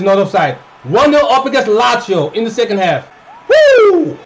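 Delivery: loud, high cheering; celebrating. Real, not acted. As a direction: celebrate the goal. Celebrating goal.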